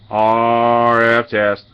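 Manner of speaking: slow on-air test call; drawn-out radio test call. Acted. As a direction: hold each letter long into the mic.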